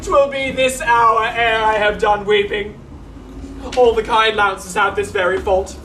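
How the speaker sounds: rueful clown lamenting his dog; comic servant Launce. Acted. Launce to the audience, a mock-tearful clown. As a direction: play the weeping fool for laughs.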